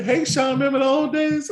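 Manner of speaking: high-pitched imitation of a woman's message; mock-flirty falsetto hello; imitated flirty message. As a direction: put on a high voice for her greeting. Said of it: "your mama hit me up".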